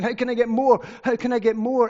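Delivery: a hint of hammed-up craving; mock greedy longing. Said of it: "he's thinking".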